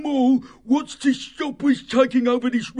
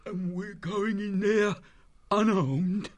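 mildly worried, hushed disbelief; shaky voice; 13 semitones higher than usual. Acted. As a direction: ask it with a touch of dread. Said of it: "Halftail's voice sounded shaky".

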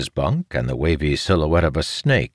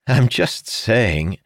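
drawn-out, higher-pitched protest; defensive; 8 semitones higher, 3 dB louder, much slower than usual. A narrator's reading. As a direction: back off defensively, stretching the words. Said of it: "raised his hands defensively".

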